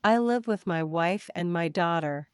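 normal talking voice

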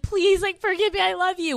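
high, begging plea, lightly acted; mock tearful pleading. Girlfriend begging boyfriend to forgive her, mock tearful pleading. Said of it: "I'm crying. He's crying".

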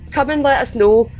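ordinary talk, nothing marked

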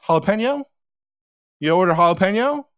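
high, rising disbelieving question; incredulous dad. Retold or imitated. Dad challenges the phone order.

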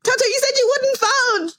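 high, crying pleading wife. Pretend. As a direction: beg him, almost sobbing. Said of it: "Susie's like".

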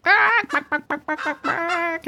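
high, rapid mock hen squawking; rapid chicken squawks. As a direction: rattle off a string of hen squawks. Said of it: "she's like".

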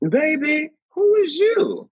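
put-on high, drawn-out older Southern lady voice; imitating Loretta. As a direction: play a sweet, puzzled elder. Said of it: "she looked at me and she said".

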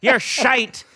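high, loud Belfast put-down; hammed-up Northern Irish cursing; mock Belfast accent. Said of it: "then I start talking that way".

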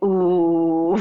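ordinary delivery

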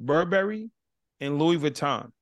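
usual speaking voice

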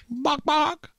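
high clucking bok bok, like a barnyard hen; chicken impression. Radio host clucks a chicken impression for co-host, high clucking. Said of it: "that's my chicken impression".

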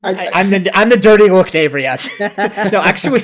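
owning up through laughter; laughing. Real, not acted. Admits being the dirty-look neighbor.

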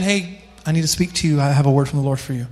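a quick, low hint of a would-be prophet; self-proclaimed prophet. Self-proclaimed prophet approaching someone with a word, lightly mocked. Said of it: "self-proclaimed prophet saying".

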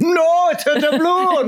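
very high, slow mock wail; exaggerated pirate echo. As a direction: screech it in parody pirate style.

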